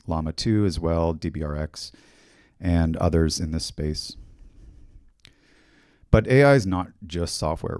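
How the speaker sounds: ordinary talk, nothing marked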